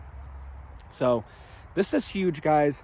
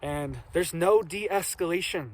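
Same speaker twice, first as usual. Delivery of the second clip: usual speaking voice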